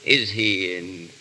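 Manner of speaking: slow, grand recitation of a silly poem; reciting his verse. Pretend. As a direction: declaim it like a proud amateur poet. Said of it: "I want you to hear my verse".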